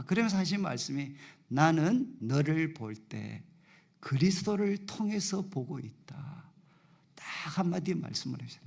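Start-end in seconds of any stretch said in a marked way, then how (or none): 1.5-6.5 slow, weighty words from God, stress on 나는; slow solemn quote. Retold or imitated. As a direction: lay each word down slowly, leaning on 나는. Quoting God's words slowly. (느리고 엄숙하게, '나는'에 힘주어)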